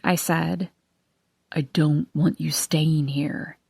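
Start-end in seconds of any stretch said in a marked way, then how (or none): 1.5-3.6 hushed, slow whisper. Acted. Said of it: "he whispered".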